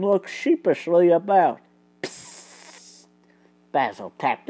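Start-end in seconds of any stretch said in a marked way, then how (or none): 2.0-3.1 unvoiced hissed psst; whispered psst. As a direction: catch his attention silently. Signalling quietly at the window.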